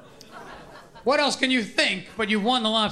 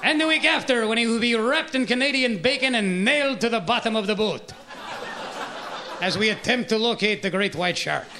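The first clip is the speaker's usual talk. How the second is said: Jacques Cousteau. Acted. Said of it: "let us join Jacques Cousteau".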